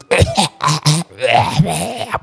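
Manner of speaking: strangled gagging retches; imitated choking. Child choking on hair while trying to speak, imitated gagging. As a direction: gag as if hair is caught in the throat. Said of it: "dia macam ter".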